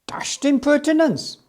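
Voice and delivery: ordinary delivery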